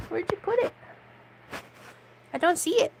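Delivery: high-pitched searching chatter to a cat; puzzled pet talk. Real, not acted. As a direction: wonder aloud way up high. Searching for cat's toy aloud.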